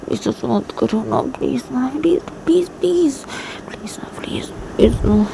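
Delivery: high, breathy whispered begging; pleading whisper. Acted. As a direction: whisper please over and over, begging. PU फुसफुसाकर मिन्नत करती है. (ऊँची फुसफुसाती आवाज़ में मिन्नत)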